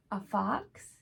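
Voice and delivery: ordinary delivery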